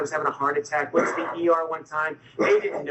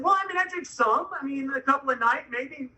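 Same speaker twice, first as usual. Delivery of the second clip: retold sheepish backtracking; 8 semitones higher than usual. Husband admitting drinking after wife's smack, sheepish backtracking. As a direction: imitate a cornered man hedging, pitched high.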